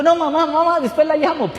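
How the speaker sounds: high, loud, urgent pleading; almost shouting it, desperate to convince her; excited insistence. Retold or imitated. Son insisting to his mother on the phone, high, loud and urgent.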